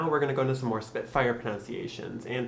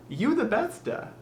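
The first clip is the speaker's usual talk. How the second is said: put-on high accented voice, extra vowel on BESTA; high-pitched mock accent; put-on regional accent; 12 semitones higher, 4 dB louder than usual. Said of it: "as someone from ikea might say".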